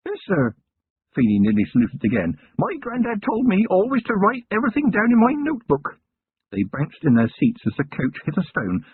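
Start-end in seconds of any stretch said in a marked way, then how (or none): 0.1-0.5 put-on lightly higher young lad voice; a hint of a keen young copper; eager young constable. Young constable answering his commander, eager. 2.6-6.0 put-on higher, earnest young lad; keen country lad proud of his notebook; eager young constable. Young constable telling his commander, earnest lad voice.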